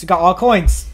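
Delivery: normal talking voice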